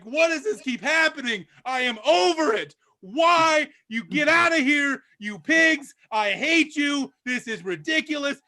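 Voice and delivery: losing his mind. Acted. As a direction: rant at the pigs, fed up. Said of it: "maitre d' is losing his mind".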